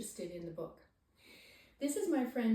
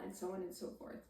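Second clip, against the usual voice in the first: usual speaking voice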